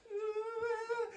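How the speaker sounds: mock toddler cry. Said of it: "she was like".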